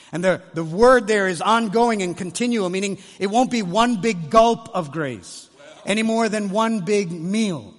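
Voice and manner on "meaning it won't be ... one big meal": ordinary delivery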